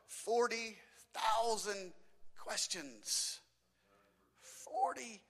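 "Forty thousand" drawn out slowly, then "questions" dropped soft; slow emphatic repeat. Real, not acted. Preacher stressing the number to parents, slow and emphatic. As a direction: savor "Forty", let it sink in. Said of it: "Parents out there, understand".

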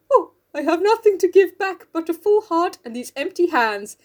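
high, plaintive put-on voice; Mister Bear. Bear offering Jo his heart, in a put-on plaintive voice. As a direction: plead as a hammed-up lovelorn suitor. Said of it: "and he goes".

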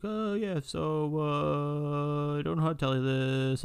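high, hesitant nervous voice; hesitant, halting confession; a friend nervously coming out. Retold or imitated. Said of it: "shaking like a leaf".

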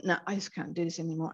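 soft, quick, worn-out surrender; exhausted giving up. Acted. Therapist voicing someone in a crisis of fatigue, giving up. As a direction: give up quietly, drained of effort. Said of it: "there becomes a crisis of fatigue".

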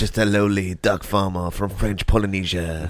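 mock French accent, slightly lifted; humble farmer in hammy French; French duck farmer. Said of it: "speaking like a French duck farmer".

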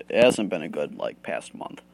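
talking while chewing gum. Real, not acted. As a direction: mouth full of gum. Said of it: "I'm just chewing my Nicorette".

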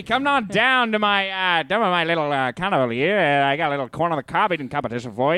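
Mid-Atlantic carnival barker pitch; Mid-Atlantic accent. Pretend. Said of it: "best Mid-Atlantic impression".

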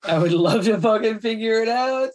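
ordinary delivery

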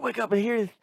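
put-on frantic, high-pitched lisping southern accent; J B going nuts. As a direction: imitate a Southerner losing it, voice way up. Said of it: "He's just going nuts".

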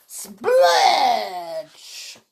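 long, bursting splatter word; splurge effect. A narrator's reading. Porter soon covered, explosive sound word. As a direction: stretch it like gushing foam. Narrator voices spraying foam.

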